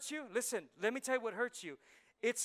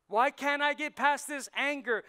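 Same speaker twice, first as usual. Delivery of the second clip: loud, high-pitched exasperated question; exasperated, raised voice; frustrated outcry; 6 semitones higher, 5 dB louder than usual. Acted. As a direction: plead it out loudly in frustration. Acts out a frustrated believer's question.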